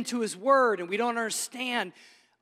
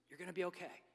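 hushed reassurance; 2 semitones lower, 17 dB quieter, faster than usual. Real, not acted. Closing word to congregation.

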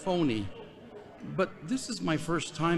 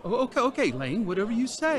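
higher, placating stammer; flustered backing down; 7 semitones higher, faster than usual. Acted. Young inmate placating a touchy older convict, flustered. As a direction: back off quickly, higher in pitch.

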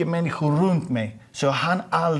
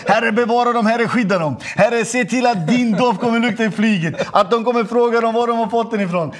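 loud, high fervent blessing, laughing; quick loud prayer; 8 semitones higher, 4 dB louder than usual. Retold or imitated. Blessing the flight attendants, praying loudly and high with joy. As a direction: rattle off a joyful blessing over them. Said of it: "då bad jag en snabb bön".

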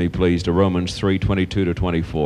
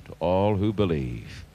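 hushed repeat; 7 dB quieter than usual. Real, not acted. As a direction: drop right down and say it quietly. Soft repeat after the shouted line.

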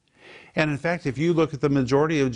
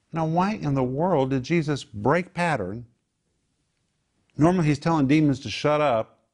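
ordinary talk, nothing marked

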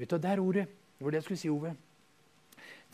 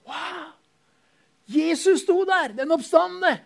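much higher, slow, awestruck; awed wonder; 12 semitones higher, 7 dB louder, slower than usual. Acted. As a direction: marvel at the risen Lord. Awed at risen Jesus.

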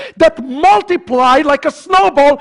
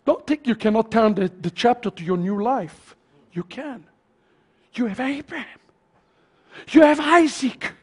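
hushed reassurance; 5 dB quieter than usual. Real, not acted. Preacher reassuring congregation of new life, hushed reassurance. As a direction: drop down to reassure them.

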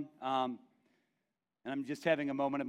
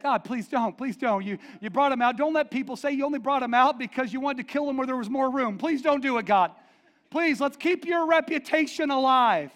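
loud, higher pleading; 9 semitones higher, 9 dB louder than usual. Retold or imitated. Moses pleading with God, loud begging. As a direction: beg God loudly and urgently. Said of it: "He's like".

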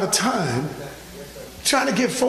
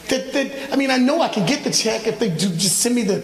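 usual speaking voice

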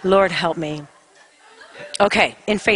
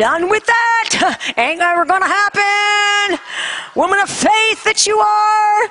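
high, triumphant crowing; gleeful exclaiming; 12 semitones higher than usual. Retold or imitated. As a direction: celebrate being off the hook. Gleeful private thoughts, acted out.

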